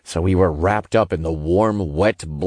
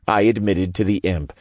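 ordinary delivery

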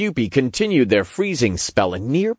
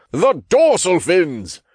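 slow, high cry of alarm; urgent warning; 8 semitones higher, 3 dB louder, slower than usual. A narrator's reading. Archangel warns the team too late, urgent warning. Raph warns of the coming attack.

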